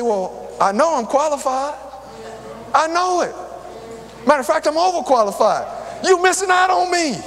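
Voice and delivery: proudly yelling that they're missing out; mock indignant boast. Retold boast to employers.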